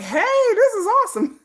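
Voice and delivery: high excited squeal with a laugh. Retold or imitated. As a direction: squeal it out, delighted. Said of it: "I'm like".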